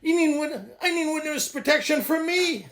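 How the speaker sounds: a hint of a blunt sponsor; voicing the sponsor. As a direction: lightly play the sponsor setting him straight. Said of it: "My sponsor says".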